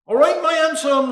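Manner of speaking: put-on a light drunken slur, pitched high; lightly voiced tipsy stranger. Drunk stranger at the door asking a favour, lightly slurred. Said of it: "slurred the stranger".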